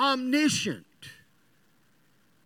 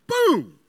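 high, loud imitated boom; sudden boom; 12 semitones higher, 5 dB louder than usual. Preacher acting out the power cutting out, sudden high boom. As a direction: pop out a booming sound effect.

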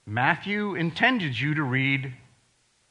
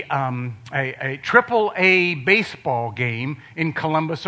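ordinary talk

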